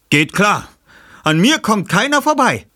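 höher und laut von der Tür gerufen; Peter ruft. Acted. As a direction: selbstbewusst quer durch den Saal rufen. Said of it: "rief Peter von der Tür". (Peter ruft laut von der Tür)

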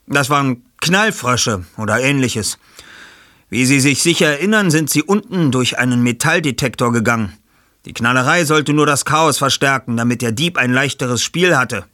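Bob, beruhigend erklärend. Acted.

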